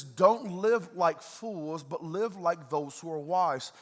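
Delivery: slow, heavy scripture quote; slow emphatic reading. Acted. Pastor reads Paul slowly to the church. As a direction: drag each word out for weight. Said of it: "He says".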